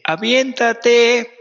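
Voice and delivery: high, loud, drawn-out call from far below. Acted. A small voice below calling to the jumper, loud and high. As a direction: shout it up to the diving board, stretched out.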